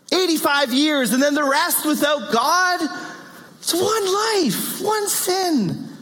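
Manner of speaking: high, pleading objection, stretched out slow on one life; mock protest. Preacher voicing a listener who objects to one sin earning hell, high pleading protest. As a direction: whine it like a skeptic bargaining. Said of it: "Then you might say".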